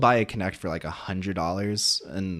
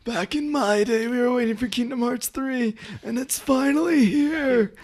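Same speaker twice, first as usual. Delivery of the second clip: put-on old man voice; 4 dB louder than usual. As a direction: play a grumpy old-timer reminiscing. Mock old-timer bit.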